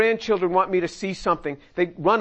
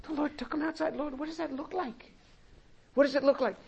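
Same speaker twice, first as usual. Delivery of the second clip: quiet wondering prayer; 6 semitones higher, 8 dB quieter than usual. Retold or imitated. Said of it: "Spent an entire morning just".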